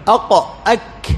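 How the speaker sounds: "أق" with a back uvular q, "أك" with a k further forward; articulation demo. Shown as a demonstration. Teacher shows qaf above, kaf below, "أق" uvular then "أك" velar. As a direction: isolate each final consonant in "أق، أك", q then k. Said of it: "القاف فوق أق".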